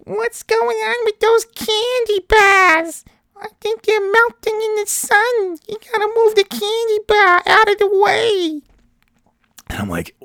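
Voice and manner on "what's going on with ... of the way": put-on extremely high, slow, squealing New Hampshire accent; ridiculous New Hampshire accent. Worried woman in line at the drugstore, ridiculous New Hampshire accent. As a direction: wail it out in a cartoonish mock New Hampshire accent. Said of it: "a ridiculous New England, New Hampshire accent".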